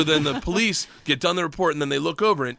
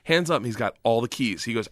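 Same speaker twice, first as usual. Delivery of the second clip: ordinary talk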